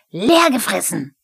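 triumphant loud cry; shouted gotcha in a kobold voice; Pumuckl. A narrator's reading. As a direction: crow it loudly. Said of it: "schrie er triumphierend".

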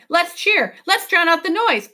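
high, rallying exclamation; excited cry. A narrator's reading. Professor rallying the children against the noise, excited. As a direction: urge everyone on eagerly. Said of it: "he cried".

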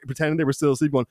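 ordinary talk, nothing marked

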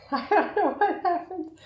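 cracking up at her own flub; laughing. Real, not acted. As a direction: giggle the words out, slowly and high. Laughing at her own odd delivery.

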